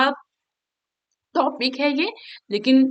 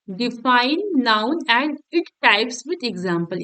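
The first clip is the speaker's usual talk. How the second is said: define noun and its type with example read very slowly, word by word; slow dictation; much slower than usual. Shown as a demonstration. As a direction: dictate each word slowly for students to copy. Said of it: "reading the question out slowly".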